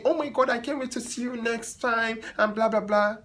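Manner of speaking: a light sugary imitation of the client; gushing client. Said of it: "hugged me".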